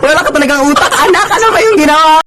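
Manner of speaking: put-on mother scolding her child after the fire. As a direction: berate the child, laughing in disbelief.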